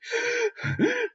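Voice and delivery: sharp acted gasp; mock gasp. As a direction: gasp as frightened pirates would. Pirates gasp before reporting.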